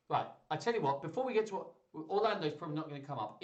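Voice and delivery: ordinary delivery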